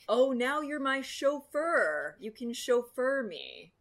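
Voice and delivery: slow teasing passenger voice; mock passenger joke. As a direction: drawl the tired joke slowly. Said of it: "will say the same joke".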